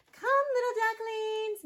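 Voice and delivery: high, singsong call to ducklings; mother duck calling. A narrator's reading. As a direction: coax the little ones over, pitched high. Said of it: "Mama called".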